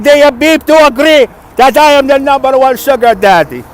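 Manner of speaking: loud, high boastful sugar daddy. Pretend. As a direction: shout it like a bragging sugar daddy. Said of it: "You like their sugar daddy voice".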